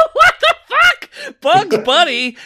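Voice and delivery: cracking up in outraged disbelief; incredulous laugh at the cohost. Real, not acted. Outraged at Bugs Bunny guess.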